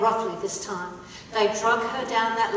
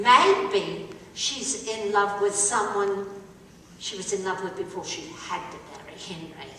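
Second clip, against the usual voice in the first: hushed gossip; 6 semitones lower, 5 dB quieter than usual. Retold or imitated. Guide whispering the rumour to visitors, hushed. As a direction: lower it to a secret. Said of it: "They said".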